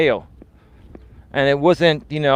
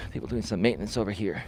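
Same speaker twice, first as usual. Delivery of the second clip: quick murmured aside; quiet aside; 7 semitones lower, 13 dB quieter, faster than usual. Real, not acted. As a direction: mutter it under your breath. Aside to camera.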